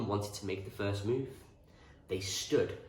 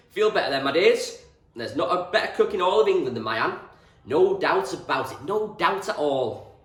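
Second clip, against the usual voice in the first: loud, higher booming farmer's voice; hearty farmer; 9 semitones higher, 11 dB louder than usual. A narrator's reading. Mister Reynolds praising Anne's cooking, booming farmer. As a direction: boom it out proudly.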